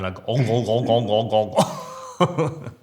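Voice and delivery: goofy imitation of dull grunting; dopey grunts. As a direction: grunt "ong" over and over like a dim deckhand. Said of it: "and you going".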